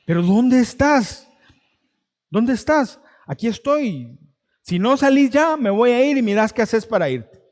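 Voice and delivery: grito fuerte e impaciente; amenázalo a gritos, harto; gritando enojado. Retold or imitated. Padre enojado amenaza irse. (padre furioso gritándole al hijo)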